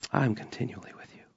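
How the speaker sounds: whisper. Acted. Intimate psalm line.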